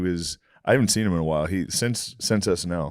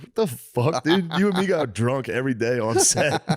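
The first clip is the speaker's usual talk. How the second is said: laughing mock outrage; 6 semitones higher than usual. Retold Q and A outburst.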